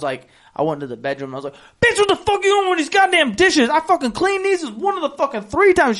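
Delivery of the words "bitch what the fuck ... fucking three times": higher and louder, a retold angry outburst at his wife; furious yell. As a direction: yell it like you just found the clean dishes back in the sink. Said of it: "I was pissed".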